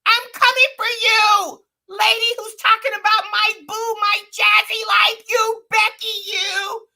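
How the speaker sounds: very high, loud imitation of a ranting woman; exaggerated high-pitched call-out; mock yelling threat. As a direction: holler it way up high, hammed-up. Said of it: "when she said".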